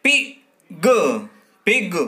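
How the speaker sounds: पिन called out high and loud, said twice; sounded out for pupils. Shown as a demonstration. Teacher to young pupils, calling out पिन loudly. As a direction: call out पिन clearly, like a teacher drilling a class. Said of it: "इन दोनों को मिलाइए तो क्या शब्द बनेगा?".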